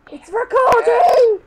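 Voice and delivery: loud, high, slow yell; drawn-out shout. Real, not acted. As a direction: stretch it out over the noise. Repeats warning over chatter.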